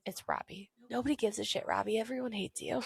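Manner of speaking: soft, half-whispered dismissal; quietly dismissive imitation; retold muttered put-down. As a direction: deliver the put-down under your breath.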